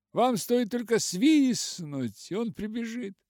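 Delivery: high, loud, cheery reassurance; cheerful exclamation. A narrator's reading. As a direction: call it out merrily, pitched well up. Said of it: "весело вскричал он". (весело, громко и высоко)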